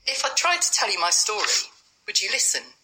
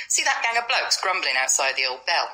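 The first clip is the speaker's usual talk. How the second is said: normal talking voice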